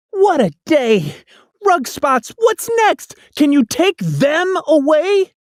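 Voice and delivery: put-on a child pleading with the cat; exasperated boy. A narrator's reading. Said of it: "I yelled".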